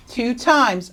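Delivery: normal talking voice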